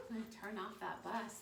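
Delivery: ordinary talk, nothing marked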